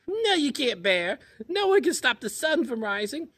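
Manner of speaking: very high, loud squeaky taunt; squeaky chipmunk. Acted. Chipmunk teasing Bear from her den in a very high squeak. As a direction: squeak it out cheekily.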